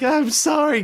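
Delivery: high, cracking apology; breaking down. Pretend. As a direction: voice breaking into a sorry. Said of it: "break down".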